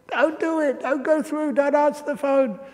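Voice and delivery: high, urgent begging to the screen; mock pleading. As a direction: plead with the hero like a gripped moviegoer. Moviegoer pleading with screen hero.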